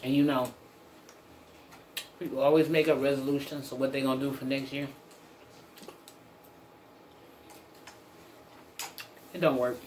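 speaking between fork bites; talking while chewing. Real, not acted. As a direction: mouth partly full of food. Seen 3: fork raised at mouth, eating from plate throughout labelled words.

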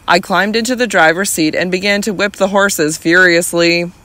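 ordinary delivery

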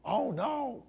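high, hammed-up shocked gasp of dismay; mock alarm. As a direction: play fake horror at the news.

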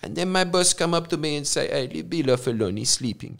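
put-on soft-spoken Croatian-accented English; Croatian dad impression. Retelling a dad's working life under Tito, Croatian accent. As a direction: imitate a dad telling his story in a Croatian accent. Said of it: "your dad describing".

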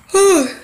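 sharp high exasperated sigh; loud high sigh. Real, not acted. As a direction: let out a loud fed-up sigh. After whisper, frustrated.

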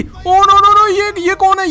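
high, loud startled no; panicked gasping no; frightened cry. Real, not acted. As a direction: yelp in fright. Lights go out, ghost appears.

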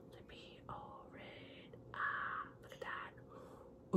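hushed, breathy whisper of awe. Real, not acted. Admiring a brick red lip product, she whispers.

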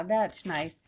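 plain reading voice

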